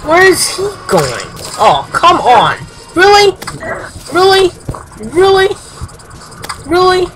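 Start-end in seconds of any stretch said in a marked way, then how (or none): 2.9-7.1 a gamer who can't believe what just happened; exasperated disbelief. Real, not acted. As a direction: cry out each "Really?" higher in disbelief. Exasperated at game, rising.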